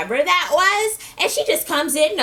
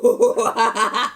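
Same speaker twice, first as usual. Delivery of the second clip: louder, rising alarmed whoa; loud mock whoa; 5 dB louder, much slower than usual. Recapper reacting to the rich man's outburst, loud and startled. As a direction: act startled and back off.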